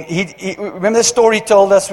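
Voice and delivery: usual speaking voice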